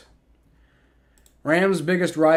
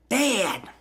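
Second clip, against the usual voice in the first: Bobby Hill voice; 5 semitones higher than usual. Pretend. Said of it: "I can't get my voice to fry like that".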